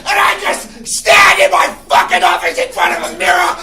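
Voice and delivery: yelling unhinged boss; boss from hell. Pretend. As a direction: manic screaming office boss. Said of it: "this is my impression of a world where I have a secretary".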